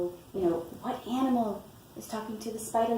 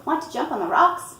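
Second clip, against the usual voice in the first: lightly voiced goat, higher and louder; perky storybook goat question, pitched up; goat character; 6 semitones higher, 7 dB louder than usual. A narrator's reading. Goat invites the spider to jump on rocks, lightly voiced. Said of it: "said the goat".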